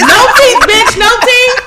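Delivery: excited. Real, not acted. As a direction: hammer the punchline. Repeating punchline loudly.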